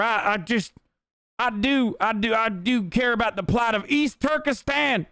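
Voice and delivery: high, slow whiny parody voice; mocking fake activist. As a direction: sneer it like a hypocrite faking concern. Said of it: "that's what motherfuckers are saying".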